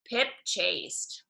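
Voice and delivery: ordinary delivery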